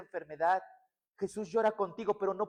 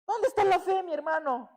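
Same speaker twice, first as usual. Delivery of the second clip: high, scolding mock voice, pitched way up; mocking accuser; 8 semitones higher, 5 dB louder than usual. Churchgoer scolding a suffering brother, mock high scolding. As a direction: needle the suffering brother as a pious busybody. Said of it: "Vemos al hermano que se está quej".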